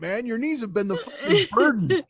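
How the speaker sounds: usual speaking voice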